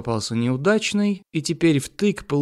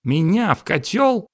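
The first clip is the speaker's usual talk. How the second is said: higher-pitched outraged question; half-roar it in protest; mild roar; 6 semitones higher than usual. Acted. Mirror fearing the cauldron, roaring. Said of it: "взревело зеркало".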